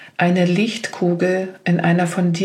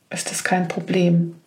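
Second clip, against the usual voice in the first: whispering; 3 dB quieter than usual. Acted. Reassurance dropped to whisper.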